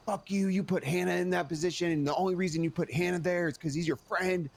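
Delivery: hammed-up accusation, not really shouted; mock furious rant. Cassidy blowing up at the speaker, retold as a mock rant. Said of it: "crashing out in front of me".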